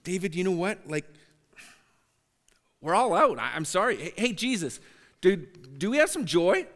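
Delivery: a jokey, put-upon spiritual shopkeeper; mock Holy Spirit. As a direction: play a flustered clerk who ran out of stock. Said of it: "Holy Spirit's never gonna say".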